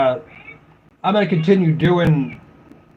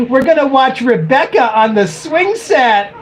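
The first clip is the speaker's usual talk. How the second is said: higher, slow teaser-trailer voice; mock show announcer; 6 semitones higher than usual. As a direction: tease the next episode like a TV promo.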